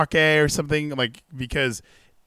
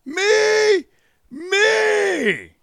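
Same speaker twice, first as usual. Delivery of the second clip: very high, loud squeaky mock voice; hammed-up falsetto Brock parody; 6 dB louder, much slower than usual. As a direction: squeal it out like a cartoon. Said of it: "have him go".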